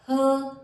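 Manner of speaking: her said as a lone, punched 'huh'; isolated h sound. Shown as a demonstration. Teacher giving learners the letter's sound, isolated h. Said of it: "और ह इसका sound है".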